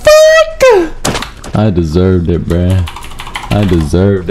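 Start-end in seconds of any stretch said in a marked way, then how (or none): 0.0-1.3 very high, wheezy squeal; hysterical disbelief. Real, not acted. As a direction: squeak it out while breathless from laughing. Gasping in hysterics after attack.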